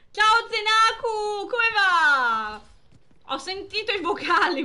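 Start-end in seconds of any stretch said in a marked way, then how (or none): 0.1-2.6 high, loud, slow wailing hello with a laughing sob; hammed-up crybaby welcome; mock whiny greeting. Streamer greeting viewer Zenaku, mock tearful wail.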